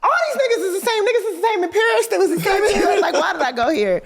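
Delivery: high, fast, loud mock rant; mock exasperated rant. Guest imagines regretting Paris, shrieking high and fast. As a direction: shriek it in exaggerated disbelief. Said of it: "I'm just like".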